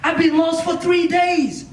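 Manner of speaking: high, insistent disbelief; acted shock. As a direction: exclaim it in mock disbelief. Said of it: "He was shocked".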